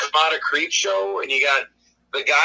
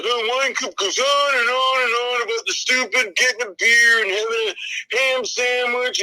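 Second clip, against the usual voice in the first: higher, whining mock-viewer gripe; mocking a grumbling audience; whiny complaint; 6 semitones higher than usual. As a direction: moan on and on like a bored viewer.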